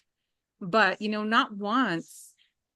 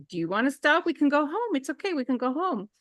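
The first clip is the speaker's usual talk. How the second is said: higher, gentle reassuring offer; comforting mom; 8 semitones higher than usual. Retold or imitated. Mother gently offering her hurt daughter to stop. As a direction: soothe her and offer to go home.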